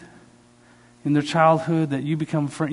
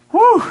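high, loud "woo"; excited whoop; 11 dB louder than usual. Retold or imitated. Thrilled at a friend's dinner invitation, an excited whoop. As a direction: whoop with sudden delight.

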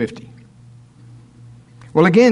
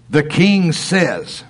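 the king says, slow, king leaned on; stress on king; much slower than usual. Shown as a demonstration. Preacher pointing out the king's order, stressed. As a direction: hammer the word king slowly. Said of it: "now notice".

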